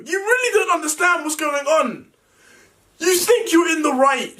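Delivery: angry high shout; heated family shouting. Real, not acted. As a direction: yell at him, furious. Heated rant at brother.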